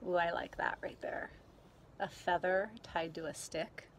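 usual speaking voice

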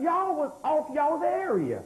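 slow, drawn-out, each word of Y'all was off y'all's area leaned on; slow emphatic. Shown as a demonstration. Preacher slowly hammering home Y'all was off y'all's area. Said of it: "repeated for the crowd".

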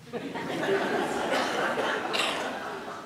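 a breathy self-conscious giggle at herself; embarrassed laugh. Real, not acted. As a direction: laugh it off, caught out reading. Laughs at own copied text.

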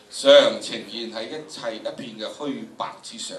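normal talking voice

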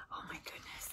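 breathless hushed whisper. Real, not acted. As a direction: whisper in delight. Awed aside.

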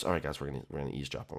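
very low, very soft murmur; hushed conspiring. Acted. Boy hiding with friends to eavesdrop, hushed. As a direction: keep it down so nobody hears.

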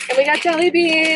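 loud, giddy cheer; excited shout. Real, not acted. Friend adding her jelly bean haul, excited shout. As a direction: yell it like a bonus prize. Celebrating the purchases.

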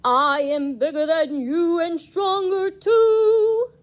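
sing-song boasting ghost; boastful ghost. Acted. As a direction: brag it like a spooky ghost. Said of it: "boasted the ghost".

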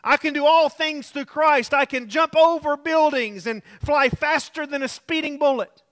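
brash, high and loud boast; cocky believer. Retold or imitated. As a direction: boast like nothing can touch you. Mock overconfident believer quote.

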